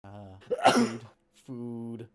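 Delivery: croaky fish voice, loud; gurgling fish man croak. Acted. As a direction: gurgle out the word food.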